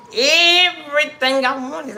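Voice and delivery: loud, high, stretched EVERYTHING; emphatic insistence. Real, not acted. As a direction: belt it out with proud emphasis. Insists proudly on having had everything.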